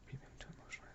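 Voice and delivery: barely audible whisper; whispered reading. Real, not acted. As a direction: read it under your breath. Rereads lyric under her breath.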